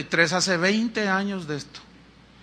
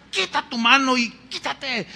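higher-pitched retold telling-off; retold scolding; 5 semitones higher than usual. Preacher recalling fans touching him, mock scolding. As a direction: mildly reenact scolding a grabby crowd. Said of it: "los regañaba y les decía".

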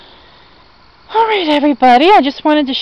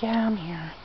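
ordinary talk

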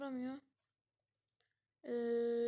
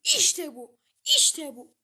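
loud triumphant shout; celebrating the win loudly; 12 dB louder, much slower than usual. Real, not acted. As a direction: bellow it in victory.

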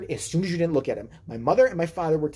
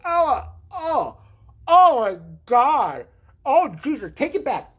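high, drawn-out horrified groaning; hammed-up revolted dismay; mock disgust; 5 semitones higher, 5 dB louder, slower than usual. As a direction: recoil from a foul smell, voice pitched up. Disgust at baby's first diaper change.

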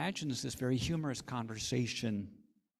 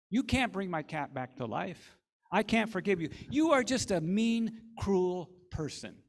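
angry refusal; 5 semitones higher, 8 dB louder than usual. Acted. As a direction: hurl the accusation at him. Nancy refuses to forgive, angry.

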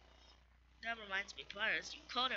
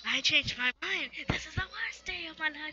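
higher, mock-dramatic complaint; Papyrus dismayed; 8 semitones higher, 5 dB louder than usual. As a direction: groan like a theatrically offended skeleton. Papyrus reacts to bad pun.

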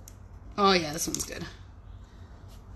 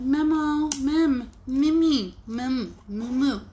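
higher-pitched silly made-up words; pet-word gibberish, pitched up; playful nonsense babble; 5 semitones higher, much slower than usual. Shown as a demonstration. Demonstrating her made-up nonsense words.

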